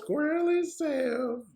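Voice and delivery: high, slow Southern twang on squirrely self; exaggerated Southern Sandy voice; Southern accent. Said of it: "Friggin' Southern accent on ten".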